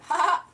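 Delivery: mock newsroom laugh; fake laugh. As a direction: fake a chuckle like a TV presenter. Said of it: "They did a fake laugh".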